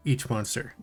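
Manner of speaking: ordinary talk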